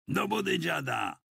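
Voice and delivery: high-pitched command; a narrator's contained shout, voice pitched right up; shouted order. A narrator's reading. Militiaman ordering an old man into the van, shouting. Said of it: "krzyknął".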